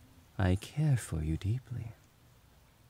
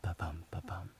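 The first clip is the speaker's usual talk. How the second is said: breathy whispered ba-bum right into the ear; whispered heartbeat; 2 semitones lower, 7 dB quieter than usual. Shown as a demonstration. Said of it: "let me whisper my heartbeat into your ear".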